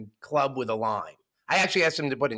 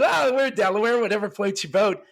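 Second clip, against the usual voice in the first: eager Biff; 7 semitones higher, 4 dB louder than usual. Acted. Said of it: "And he goes".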